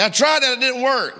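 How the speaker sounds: high, loud mock-whiny objection; hammed-up listener talking back to the preacher; mock complaint. Mocking a doubting listener's reply.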